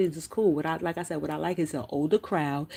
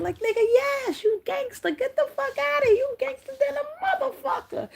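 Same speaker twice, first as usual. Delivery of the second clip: calling his bluff, half laughing, half yelling; incredulous outburst. Real, not acted. Calling out Bishop's innocent act.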